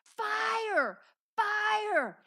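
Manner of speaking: loud, high alarm shout, drawn out; full-voiced panicked cry for help; screaming in terror. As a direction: scream the alarm as if the house is burning. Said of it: "I began to scream".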